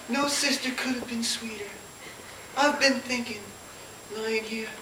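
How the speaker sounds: a frail invalid barely able to speak; faint dying voice. Acted. Dying Melanie speaks to Scarlett.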